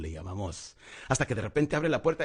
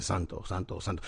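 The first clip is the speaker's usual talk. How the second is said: mock monotone chant like a prayer, softer and lower; mock praying drone; 6 semitones lower, 6 dB quieter than usual. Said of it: "Parece que estamos rezando". (rezo monótono de burla, bajo y suave)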